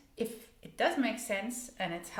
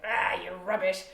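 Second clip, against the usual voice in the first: harsh self-scolding, louder; mean to yourself; 8 dB louder than usual. Retold or imitated. Learner scolding herself harshly. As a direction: snap it at yourself. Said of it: "mean to yourself".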